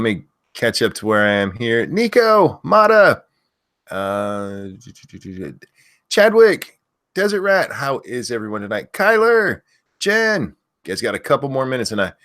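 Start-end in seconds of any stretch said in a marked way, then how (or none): 1.9-3.2 loud high name shout; screaming names. Real, not acted. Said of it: "I have to stop screaming that". 6.1-7.7 loud high name shout; screaming names. Real, not acted. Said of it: "I have to stop screaming that". 8.9-10.4 loud high name shout; screaming names. Real, not acted. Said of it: "I have to stop screaming that".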